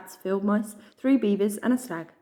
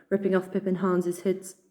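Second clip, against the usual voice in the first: ordinary delivery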